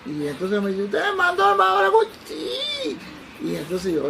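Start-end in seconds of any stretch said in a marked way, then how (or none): 0.8-2.0 put-on high, loud, fast drunken yell; shouting. Drunk man loudly confirming the doctor's identity. 2.2-3.0 very high squeaked yes; very thin yes. Retold or imitated. Frightened doctor answering the drunk man, squeaky. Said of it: "el sí me salió un poco fino".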